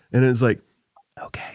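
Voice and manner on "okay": imitating a whispering voice assistant; very soft, hushed whisper back; whispered reply. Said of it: "She whispers back".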